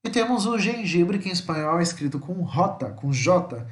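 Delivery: ordinary talk, nothing marked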